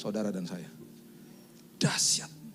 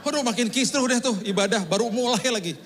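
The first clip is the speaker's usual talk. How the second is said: laughing; 6 semitones higher, 5 dB louder than usual. Real, not acted. Laughing at the chaos.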